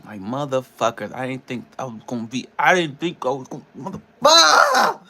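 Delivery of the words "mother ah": high, drawn-out cry of frustration; a real, strained cry that cuts off the curse; frustrated cry. Real, not acted. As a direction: let the word break into an exasperated wail. Frustrated over missing paycheck.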